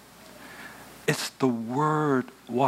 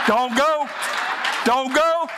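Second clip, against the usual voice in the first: urgent warning; 14 semitones higher, 4 dB louder, slower than usual. Acted. Pleading warning to husband.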